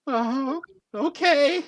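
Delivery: high, timid squeak; trembling. Acted. Trembling volunteer obeying the giant, high frightened reply. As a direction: agree nervously in a high voice.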